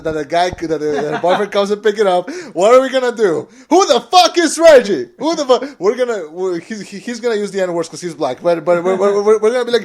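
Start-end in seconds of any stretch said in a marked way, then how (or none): 3.7-5.8 high, loud indignant yell; comic protective-father outburst; mock outraged dad. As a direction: bellow it like an incensed dad, hammed up. Acting out fathers confronting daughter's date.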